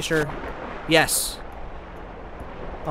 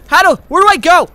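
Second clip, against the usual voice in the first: loud, high, flustered question; frantic panic; 11 semitones higher, 11 dB louder than usual. Real, not acted. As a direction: ask it in a real panic. Lost while under attack.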